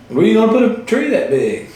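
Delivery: a laughing, hammed-up quote; quoting the movie. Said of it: "it's like".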